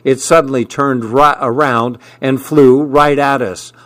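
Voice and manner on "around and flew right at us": plain reading voice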